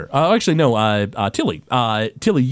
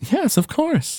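higher, slow sweet gnome lady; gnome woman voice; slower than usual. Acted. Rock gnome woman greeting the player sweetly. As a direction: greet them with sugary delight.

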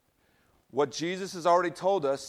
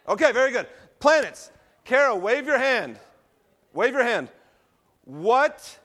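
excited; 7 semitones higher, 7 dB louder than usual. Real, not acted. Lively classroom demo with volunteers.

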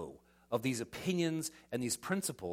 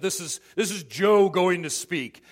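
loud, slow herald voice; pompous announcer; 10 dB louder, slower than usual. Retold or imitated.

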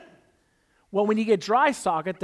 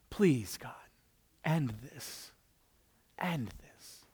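soft, drained begging, voice sinking away; weary hushed plea; 5 semitones lower, 9 dB quieter, slower than usual. Retold or imitated. Pastor reliving his prayer to God in pain, hushed plea. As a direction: plead quietly like you can't take any more.

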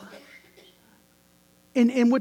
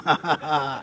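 mock laugh; 7 semitones lower, 4 dB quieter than usual. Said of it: "He's going".

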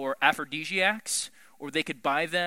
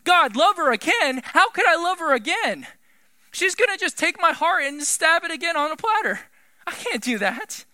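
much higher, louder, pained disbelief; anguished protest; 11 semitones higher, 8 dB louder than usual. Acted. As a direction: cry out to God in hurt. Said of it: "the emotional turmoil within his heart".

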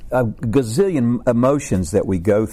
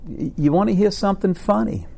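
high-pitched joke voice; 10 semitones higher than usual. Acted. Abraham calls to his friend Caleb, high comic voice. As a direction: pitch it way up like an eager old friend.

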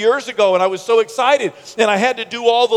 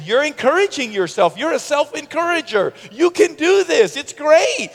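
high-pitched teasing cheer; mocking wife; 9 semitones higher than usual. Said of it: "She was mocking me".

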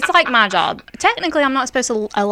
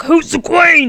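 mock kids' question; 7 semitones higher, 7 dB louder, much slower than usual. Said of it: "They're like".

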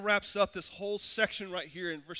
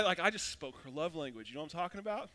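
ordinary delivery

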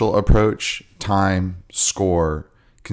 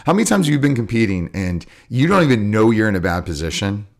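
ordinary talk, nothing marked

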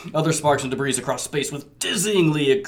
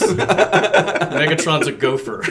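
laughing; 3 semitones higher, much slower than usual. Real, not acted. Laughing joke line.

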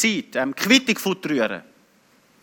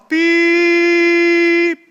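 long, steady high-pitched beep; sustained monotone "piep" like a flatline; imitated beep; 10 semitones higher than usual. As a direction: hold one high electronic tone. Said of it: "Und dann hat's gemacht".

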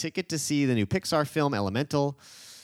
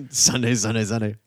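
booming hype announcer voice; monster truck announcer; 5 dB louder than usual. Pretend. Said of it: "monster truck announcer".

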